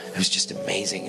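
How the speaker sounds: whispered in awe; hushed whisper. Retold or imitated. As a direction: let the wonder drop to a whisper. Retold awed testimony to a man.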